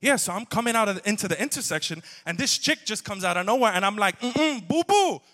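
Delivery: loud, high street-talk rant; sassy, heated street voice, imitating a friend. Friend ranting about a driver before answering, loud street voice. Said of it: "Lee goes from street to sweet".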